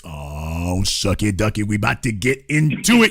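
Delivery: a low teasing growl of a setup; mock deep voice. Teasing the audience with a low mock voice. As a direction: drop the voice low and play it sly.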